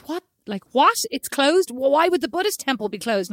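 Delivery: imitating his disbelief, pitch jumping up; incredulous. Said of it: "like, incredulous".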